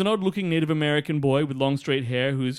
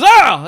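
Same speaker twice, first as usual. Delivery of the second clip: high, loud startled cry; retold scream; 9 semitones higher, 14 dB louder than usual. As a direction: yelp like you're startled.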